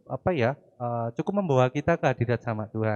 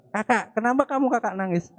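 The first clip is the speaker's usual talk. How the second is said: father asking; 9 semitones higher than usual. Acted. Father voiced asking crying child.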